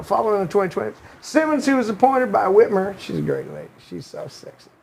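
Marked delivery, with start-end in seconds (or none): 0.0-3.0 Bill Clinton voice. Retold or imitated. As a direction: read the article as a mild Clinton impression with a Southern accent. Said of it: "in the Clinton voice".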